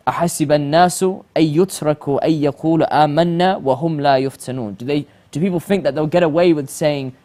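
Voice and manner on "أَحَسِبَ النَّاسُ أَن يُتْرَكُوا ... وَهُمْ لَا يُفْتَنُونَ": rapid, melodic Quran chant, louder; Quranic recitation. Acted. Host quotes the verse to the audience, recited in chant.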